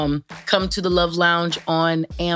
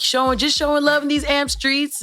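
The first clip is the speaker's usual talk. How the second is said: higher, sing-song catchphrase; imitating E-Man; 9 semitones higher than usual. Host imitating the late creator E-Man's catchphrase, higher and sing-song. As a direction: toss off his greeting like a cheerful regular. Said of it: "he would just come in and be like".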